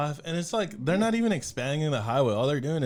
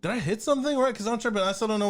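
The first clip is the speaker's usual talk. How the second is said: confused question; 8 semitones higher, faster than usual. Retold or imitated. Confused on the call.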